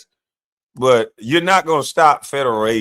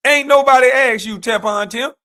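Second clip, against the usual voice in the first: louder, higher, scolding call-out; 8 semitones higher, 3 dB louder than usual. Real, not acted. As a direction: yell it straight at him. Scolding the governor directly.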